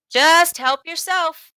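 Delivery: slow, high coaxing reassurance; reassuring. A narrator's reading. Storekeeper assuring John, reassuring. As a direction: soothe his doubts. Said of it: "assured him".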